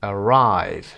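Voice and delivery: "Arrive" with RIVE stretched out; short first syllable, then lean long on RIVE in "Arrive"; a-RRIVE, weight on the end; stress on second syllable. Shown as a demonstration. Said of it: "قسمت دوم".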